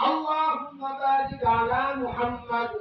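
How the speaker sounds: slow, low devotional chant; slow Arabic salawat chant; durood recitation. Acted. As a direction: draw out each phrase reverently.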